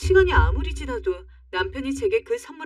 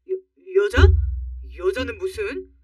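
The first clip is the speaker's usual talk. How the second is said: acted flustered husband, tripping into a denial; flustered stammer; 3 dB louder, slower than usual. Husband accused of seeing another woman, flustered stammer. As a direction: stumble on the first word, caught out. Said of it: "제 눈도 못 쳐다보고 버벅거리는".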